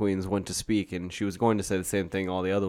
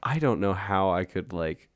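usual speaking voice

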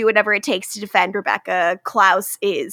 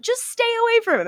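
squeaky Marcel; 12 semitones higher, much faster than usual. Retold or imitated. Marcel voiced in recap.

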